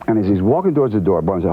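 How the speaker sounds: normal talking voice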